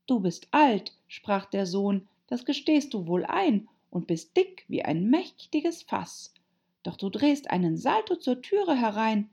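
put-on high-pitched girl reciting verse; high, measured poem recital; Alice reciting. A narrator's reading. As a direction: recite it like a schoolgirl.

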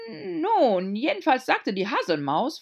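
timid little hatter. A narrator's reading. Hatter voiced.

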